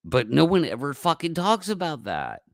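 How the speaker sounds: usual speaking voice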